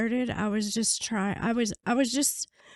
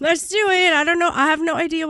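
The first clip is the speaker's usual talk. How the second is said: high, loud and laughing; psyched, laughing go-for-it; excited; 6 semitones higher, 7 dB louder than usual. Retold or imitated. Laughing while committing to it.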